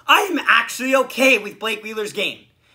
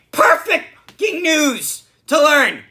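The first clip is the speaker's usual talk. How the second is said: slow, higher, sarcastic outburst; bitter sarcasm; 5 semitones higher, 4 dB louder than usual. Real, not acted. Fan reacting to a player's injury news, bitterly sarcastic. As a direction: draw it out with angry sarcasm.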